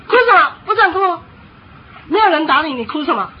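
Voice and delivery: loud, high burst of parental sternness; fierce scolding. Acted. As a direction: demonstrate shutting a child down forcefully. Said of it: "更凶的给他压下去".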